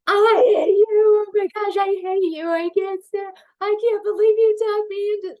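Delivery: very high, loud crying shriek; screaming in terror. Retold or imitated. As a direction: wail at the friend who talked you onto the ride. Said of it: "my friend's mantra the whole time was".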